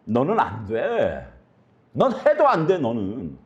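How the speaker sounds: sneering voice of Satan, rising to a high sharp push; mock the believer as the tempter; Satan taunting. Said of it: "원수 사단은 우리에게 이렇게 속삭입니다". (사단의 비웃는 목소리)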